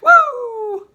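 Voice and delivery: loud, very high-pitched "woo"; drawn-out falsetto whoop. Acted. Repeats whoop after brakes line.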